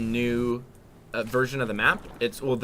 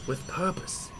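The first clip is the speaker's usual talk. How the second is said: soft, higher, sage-like mountain hermit; wise sensei voice; 5 semitones higher, 7 dB quieter, slower than usual. Shown as a demonstration. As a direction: intone it like a wise old master. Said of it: "In my best sensei voice".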